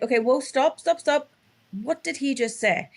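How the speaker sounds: high-pitched, insistent "stop" to a runaway talker; urgent interruption. Retold or imitated. Coach interrupting a client who is not listening, urgent halting.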